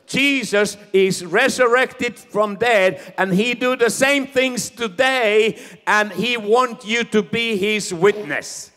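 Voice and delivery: loud, high declaration of faith; bold proclamation. Shown as a demonstration. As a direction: proclaim it to your neighbor. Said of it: "And say to that person".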